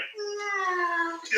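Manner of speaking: high, held squeak; imitated suppressed laughter. Storyteller shows himself failing to answer Roy, high stifled squeal. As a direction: squeeze out one long high squeal, holding laughter in. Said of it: "And I'm like".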